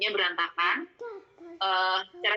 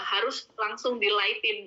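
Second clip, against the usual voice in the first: ordinary talk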